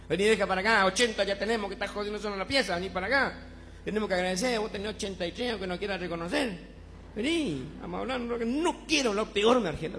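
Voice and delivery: llamando fuerte a Margela, más alto y más fuerte; llamado a la esposa. Acted. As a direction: insiste llamando a su mujer. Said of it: "Ella se acuerda y va a la pieza". (anciano llamando fuerte a su esposa Margela desde la pieza)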